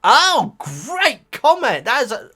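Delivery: high, loud, drawn-out praise; delighted. Real, not acted. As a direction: gush slowly over the comment. Reacting to a viewer comment.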